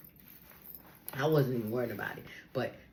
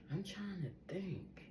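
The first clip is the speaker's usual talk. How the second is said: whispered aside; 5 semitones higher, 13 dB quieter, slower than usual. Real, not acted. Musing aloud to herself.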